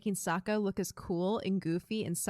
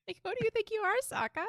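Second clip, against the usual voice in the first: mocking; faster than usual. Mocking Sokka's hug.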